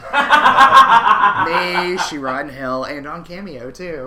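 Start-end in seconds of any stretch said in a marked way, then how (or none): 0.1-2.8 loud, slow laughing curse. Real, not acted. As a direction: drag it out through laughter.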